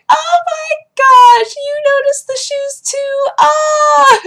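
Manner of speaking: put-on squealing high, slow delighted old lady; adorable old lady. Old lady thrilled someone noticed shoes, squealing delight. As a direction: gush way up high, stretching the words. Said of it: "adorable little old ladies that were like".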